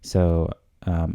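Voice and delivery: ordinary talk, nothing marked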